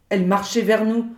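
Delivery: ordinary delivery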